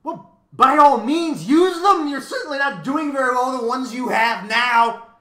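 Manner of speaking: very high, very loud bird cry; high-pitched, gleeful yelling; happy crowing. A narrator's reading. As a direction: crow it at full blast. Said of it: "cried the bird happily".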